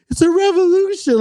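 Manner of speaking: very high-pitched plaintive wail; mock crying lady. Crying lady explaining why she did it, high mock wail. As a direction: imitate a tearful, over-earnest protester. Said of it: "she's crying".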